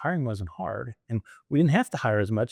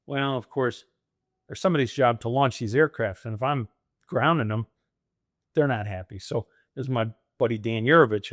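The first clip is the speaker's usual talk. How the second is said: ordinary delivery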